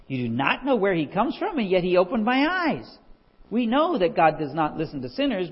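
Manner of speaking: emphatic retort. Acted. Healed man answering the Pharisees, emphatic retort.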